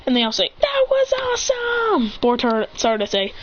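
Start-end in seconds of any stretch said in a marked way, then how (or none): 0.6-2.1 high, drawn-out excited exclamation; excited cheer. Acted. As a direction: cheer it slowly at a high pitch. Group exclaims after the outing.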